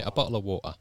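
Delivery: imitating a casual English accent on "bottle of water"; loose, everyday English accent, dropping the t in "water". How they'd say it back in England, casual English accent. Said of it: "In England, they'd say".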